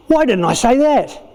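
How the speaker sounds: high-pitched mock exasperation; acted after-the-fact frustration; sudden regret. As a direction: kick yourself for the missed comeback. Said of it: "all of a sudden you go".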